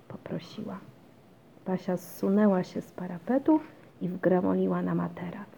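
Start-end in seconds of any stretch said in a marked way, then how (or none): none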